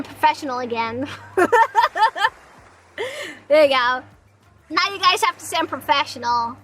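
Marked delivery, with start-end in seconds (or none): none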